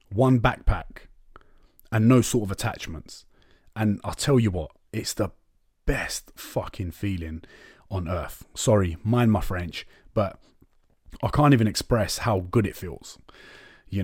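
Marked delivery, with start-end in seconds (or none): 4.9-8.5 soft, slow emphasis. Real, not acted. Savouring freedom.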